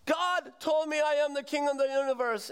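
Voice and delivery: mock madman insisting; deluded madman. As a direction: proclaim it like a deluded patient. [name] answers the doctor.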